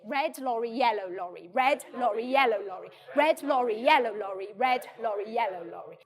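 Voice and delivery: red lorry, yellow lorry with every consonant hit crisply; tongue twister drill. Shown as a demonstration. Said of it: "So let's just say it".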